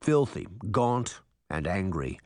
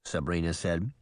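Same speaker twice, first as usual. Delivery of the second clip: ordinary delivery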